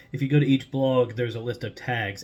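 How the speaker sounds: ordinary talk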